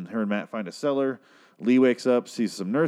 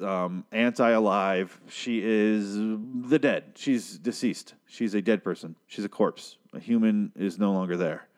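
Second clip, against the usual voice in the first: slow deadpan; slower than usual. Real, not acted. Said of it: "overstating she's dead slowly".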